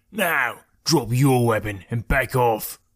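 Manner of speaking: slow, threatening character voice; menacing bandit leader. A narrator's reading. Bandit holding sword to traveller's throat, threatening slowly. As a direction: order him back, slowly and deliberately.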